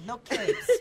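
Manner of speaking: Edna Mode voice. Acted. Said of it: "The Edna Mode voice".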